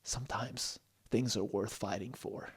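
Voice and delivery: soft, weighty reply; hushed, sincere hero's line; quietly earnest Peter. Acted. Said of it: "he looked at Stella and said".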